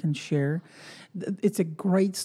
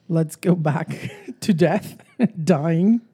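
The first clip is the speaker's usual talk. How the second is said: laughing; 3 dB louder, slower than usual. Real, not acted. Nervous laugh on grim topic.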